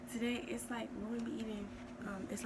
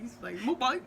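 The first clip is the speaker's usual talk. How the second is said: mock Chinese accent; 3 semitones higher, 7 dB louder than usual. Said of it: "you were trying to sound Chinese".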